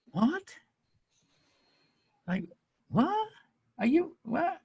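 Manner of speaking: high, incredulous what, rising; baffled what. Retold or imitated. Retelling his reply to Ryan's message, high and baffled. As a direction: sound baffled by the strange message. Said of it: "And I went".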